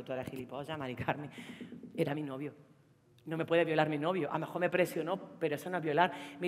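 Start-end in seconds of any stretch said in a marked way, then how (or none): 0.0-1.3 muttered insult. Retold or imitated. Retorts to the psychologist.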